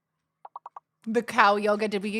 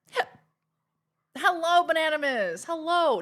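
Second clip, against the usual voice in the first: loud greeting; 6 semitones higher, 7 dB louder than usual. Real, not acted.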